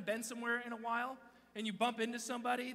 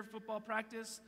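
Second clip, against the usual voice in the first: ordinary talk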